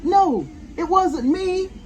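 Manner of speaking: high, slow protest; emphatic denial. Acted. David denying he bit the cake, emphatic protest. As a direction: drag out a child's innocent denial. Child denies biting the cake.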